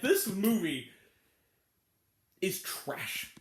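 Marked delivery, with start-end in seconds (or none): 2.4-3.3 much lower, whispered contempt; whispered verdict. Real, not acted. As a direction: breathe it out like a secret. Punchline verdict on the movie.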